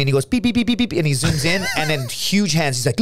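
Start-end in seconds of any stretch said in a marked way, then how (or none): 0.3-0.9 high, quick electronic beeps; imitated camera beeps. Imitating the camera as his buddy zooms in, high beeps. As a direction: mimic a zoom button, pitched way up.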